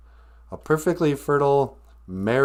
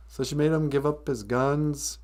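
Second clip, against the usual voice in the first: ordinary talk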